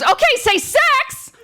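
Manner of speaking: high, sing-song shop photographer; sweet and bright, oblivious; chirpy photographer. Retold or imitated.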